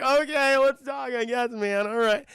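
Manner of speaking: high, loud put-on voice; a hint of a worked-up man giving in; lightly acted jumper. Jumper giving in to the cop, in a mock high voice. Jumper reluctantly agrees to talk.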